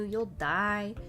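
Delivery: normal talking voice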